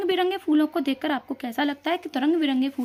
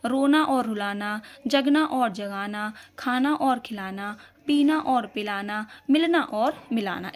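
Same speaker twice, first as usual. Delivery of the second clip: slow, deliberate word pairs; slow listing; 3 semitones lower, slower than usual. Shown as a demonstration. Teacher dictating word pairs slowly to pupils. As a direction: space each pair out for the class to copy. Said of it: "जैसे हंसना और हंसाना".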